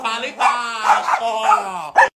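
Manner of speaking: high, very drawn-out command; long, high-pitched telling-off; exasperated hushing. Real, not acted. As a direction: drag it out high over the barking. Yells at the barking dog.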